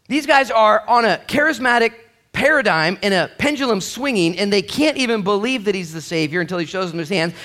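full-force preaching. Real, not acted. Pastor rousing the church, full-force preaching. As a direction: lift the room with it.

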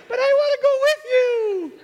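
very high whiny dog voice, laughing; pleading dog voice. Pretend. Dog begging its owner to come along, high and whiny. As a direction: beg like a puppy left behind. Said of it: "here's the dog says".

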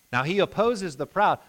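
ordinary delivery

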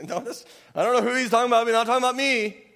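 fast, high, loud indignant brush-off; mock denial. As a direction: rattle it off as if it can't possibly be you. Mocking people denying the verse.

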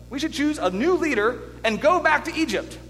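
rebellious urging. Acted. Israelites urging each other to turn back, rebellious urging. People grumbling rebelliously in retold scripture.

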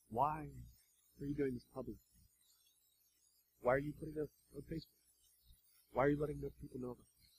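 very soft, fast questions; hushed questioning. Acted. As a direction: probe softly.

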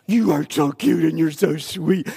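imitating wife's baby coo. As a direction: coo like a doting grandma. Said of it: "She goes".

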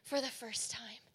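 very soft, hushed retelling; tearful awe, imitated. Preacher quoting campers who first heard God, hushed and moved. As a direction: quote them barely above a murmur. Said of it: "with tears in their eyes".

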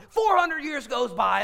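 high, loud, drawn-out cry; climactic shout. Real, not acted. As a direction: belt out the passing centuries slowly. Climax of counting the centuries.

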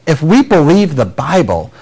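usual speaking voice

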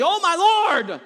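loud, high wail of distress; dramatic desperate outburst; anguished cry out. Acted. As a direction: cry out as if all is lost. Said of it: "I mean, it clearly".

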